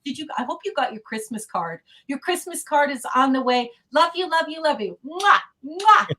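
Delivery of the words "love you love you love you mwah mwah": loud, high, affectionate goodbye kisses; talking between kisses. Real, not acted. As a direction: blow kisses between the words. Blowing kisses goodbye to friend.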